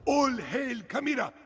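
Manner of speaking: high, ringing proclamation; hailing the queen. Acted. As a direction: proclaim her name to the crowd. Herald hails the new queen.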